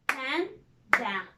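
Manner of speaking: "Panda" as pan, pause, da; two separate beats on "Panda"; split into syllables. Shown as a demonstration. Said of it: "we divided the word into two".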